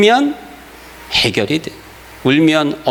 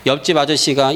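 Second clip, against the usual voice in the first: usual speaking voice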